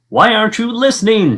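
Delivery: high, loud exasperated shout. Acted. The king shouts at Boy who can't hear. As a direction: yell it at the boy. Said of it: "shouted the king".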